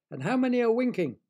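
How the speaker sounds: high, loud teasing question. Acted. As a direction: tease them, high and bright. Mischievous voice teasing children.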